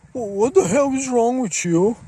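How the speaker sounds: high, indignant squeal; mock outrage. Mimicking a hostile stranger online, high and outraged. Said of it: "he's gonna be like".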